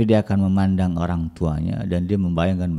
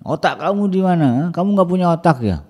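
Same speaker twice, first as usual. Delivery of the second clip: higher and louder, insulting; a shouting parent belittling the child; demeaning angry father; 9 semitones higher, 5 dB louder than usual. Retold or imitated. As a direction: berate the child harshly.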